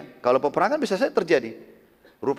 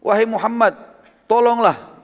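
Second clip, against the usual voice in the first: raised, louder retold cry for help; begging in mock terror; frightened plea; 6 semitones higher, 5 dB louder, slower than usual. Frightened pagan begs Muhammad for rescue, raised frightened plea. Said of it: "Karena ketakutan".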